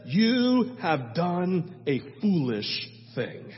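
slow, grave judgment; solemn slow rebuke; stern prophet. Retold or imitated. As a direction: pronounce the verdict slowly. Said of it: "looks at him and says".

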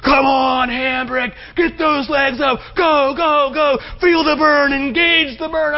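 high, loud drill-style urging; screaming coach. Acted. PE coach to a slowing runner on the track, screaming. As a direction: holler it like a pushy gym coach. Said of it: "dumb coach over here screaming in your ear".

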